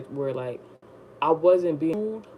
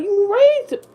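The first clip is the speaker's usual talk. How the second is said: much higher and louder accusing shout; indignant shout; 8 dB louder than usual. Retold or imitated. Accusing the mother about her son, indignant loud retort. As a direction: snap it at her.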